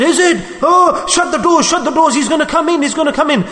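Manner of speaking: high, frantic plea to shut the doors; a sick woman gripped by fear of the visitor; panicked mother. Retold or imitated.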